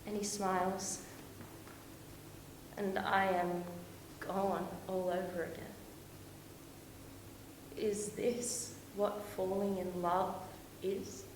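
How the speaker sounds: hushed and smitten; tender wonder. Acted. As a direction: muse quietly, falling in love. Wonders at falling in love.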